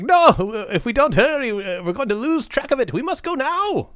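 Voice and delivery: high, urgent little voice; squeaky leprechaun hurrying the party. Retold or imitated. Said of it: "he's like".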